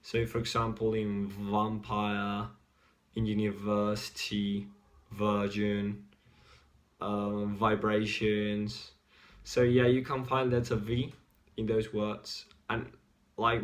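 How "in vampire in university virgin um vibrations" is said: "vampire", "vibrations" with a long V; slow, drawn-out V sound with lots of buzz. Shown as a demonstration. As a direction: stretch the V in "vampire", "university", "virgin", "vibrations" slowly. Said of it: "longer with more vibrations".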